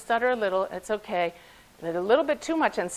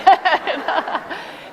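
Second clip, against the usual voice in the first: bright laugh at own joke; 8 semitones higher, 6 dB louder than usual. Real, not acted. Joke about husband away.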